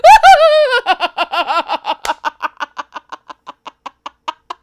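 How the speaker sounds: loud, high, long peal of laughter; hysterical laughter. Real, not acted. Player cracking up at the game, hysterical laughter. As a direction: laugh helplessly, slowly running out of air.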